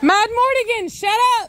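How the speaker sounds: high, sharp yell across the yard; real yell. Real, not acted. Owner at a barking dog, real yell.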